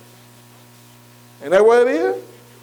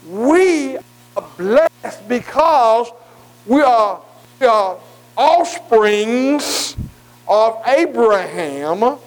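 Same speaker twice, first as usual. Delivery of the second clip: drawn-out declaration; much slower than usual. Acted. Preacher stretching key declaration.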